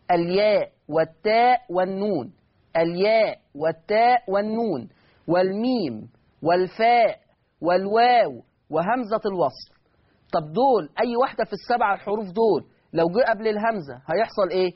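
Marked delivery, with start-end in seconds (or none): 0.1-9.7 each letter name spaced out slowly for copying; slow dictation. Shown as a demonstration. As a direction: dictate slowly so they can write. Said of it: "ممكن تعيدهم تاني عشان نكتبهم".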